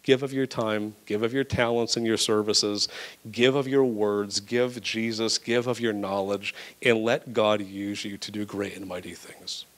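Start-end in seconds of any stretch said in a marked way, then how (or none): none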